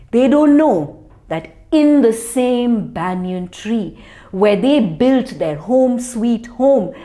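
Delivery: usual speaking voice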